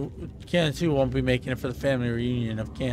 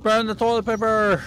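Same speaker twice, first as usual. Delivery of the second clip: shouted; 8 semitones higher, 4 dB louder than usual. Acted. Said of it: "repeat in put-on voice".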